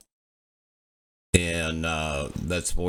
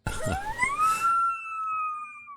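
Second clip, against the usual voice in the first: high giggle. Real, not acted. Giggling at the puppy video.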